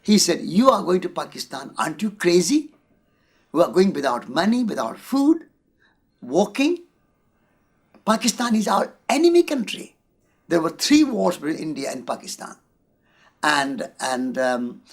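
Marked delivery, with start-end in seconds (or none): 0.4-10.0 imitating an alarmed friend, pitch climbing higher; a friend pleading he's mad to go; worried friend. Friend at the border begs him not to enter Pakistan, retold alarmed. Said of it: "was extremely worried".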